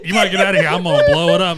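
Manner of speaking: mock warning shout. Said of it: "heard this man go".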